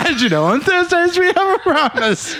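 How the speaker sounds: laughing, high announcer voice; mock formal announcement. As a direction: say it like a solemn notice. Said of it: "And that was the tone".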